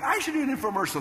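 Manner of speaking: high-pitched eager realization; retold burst of enthusiasm; excited idea. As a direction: blurt it like a sudden bright idea.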